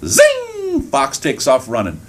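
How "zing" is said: very high, stretched zing; zinging escape noise. Acted. Fox shoots out of the trap, zinging. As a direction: let it fly out high like a shot.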